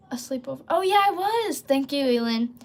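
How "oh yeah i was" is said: bright jump up, a louder oh yeah; suddenly remembering, a burst of pitch; sudden recollection. Real, not acted. Streamer remembering her topic thanks to chat, excited.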